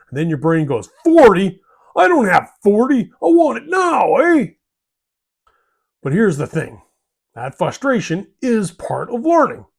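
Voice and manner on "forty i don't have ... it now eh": loud, high whiny protest; mock impatient outburst. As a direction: throw a hammed-up tantrum. Impatient brain protests in acted exchange.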